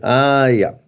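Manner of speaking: drawn-out "aha, I get it"; mock realization. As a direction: re-enact the penny dropping, stretching the ah. Retells suddenly deciphering own handwriting. (gespieltes Aha-Erlebnis)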